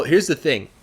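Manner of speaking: plain reading voice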